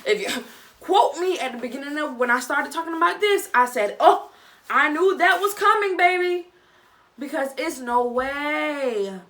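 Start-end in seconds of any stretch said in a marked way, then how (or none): 4.0-6.5 loud, high I-told-you-so; vindicated exclamation. Retold or imitated. Viewer who called it from the beginning, vindicated. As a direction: crow it out loud. Crowing that she predicted it.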